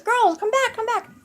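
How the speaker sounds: high-pitched calling out; imitated dad's yell. Dad calling his girls back to him, imitated yell. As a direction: call after runaway kids, mimicking a frantic parent. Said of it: "My poor dad is yelling at".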